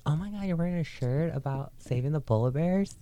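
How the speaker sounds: put-on high, gushing valley girl; mock excited valley girl.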